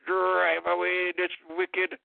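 normal talking voice

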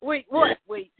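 imitating a family panicking at the news; panicked disbelief. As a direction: blurt it out in sudden alarm. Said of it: "we're like".

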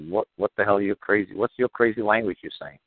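ordinary delivery

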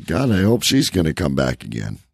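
ordinary delivery

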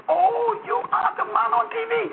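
very high, mock Haitian-accented astonishment; high-pitched Haitian old lady impression. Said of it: "she could hardly speak English".

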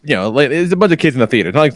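ordinary talk, nothing marked

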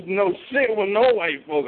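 ordinary delivery

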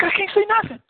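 high, incredulous cry of helplessness; a preacher mimicking his own helpless protest in the dark, squeaked high; exasperated disbelief. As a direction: throw your hands up, squeaky with disbelief. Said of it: "I'm like".

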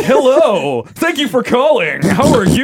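high, loud, slow mock phone greeting; exaggerated goofball answering the phone; goofball radio voice. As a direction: ham up a goofy announcer hello. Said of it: "she just thought I sounded like a goofball".

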